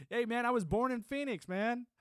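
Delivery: high and loud, bursting out; excited. Real, not acted. Telling a chat friend where he was born, excitedly loud. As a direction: blurt it out with a grin.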